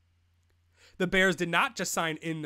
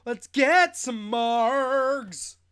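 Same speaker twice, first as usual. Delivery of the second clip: higher, slow and louder, savoring each word; drawn-out dreamy voice; 5 semitones higher, 7 dB louder, much slower than usual. Pretend. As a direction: stretch it out like a lazy invitation. Daydreaming of Dallas hangout.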